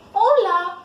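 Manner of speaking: loud, bright call of hola, pitched high; cheerful hola. Pretend. Calling hola out to people hurrying past. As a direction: greet a passer-by with a loud, happy hola. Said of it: "if you say".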